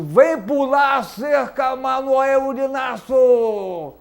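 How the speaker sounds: loud, drawn-out call to the guest. Real, not acted. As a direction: shout his name like an announcer. Host calls the guest in.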